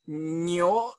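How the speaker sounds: にょ with a long ng-like nasal start; nasal NG onset. Shown as a demonstration. Lecturer showing the old sound of cow, nasal pronunciation demo. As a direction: hum into にょ slowly through the nose. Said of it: "エヌジーみたいなですね、鼻音ですからね".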